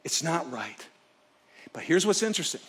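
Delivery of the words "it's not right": quiet inner complaint. Retold or imitated. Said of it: "His internal issue was".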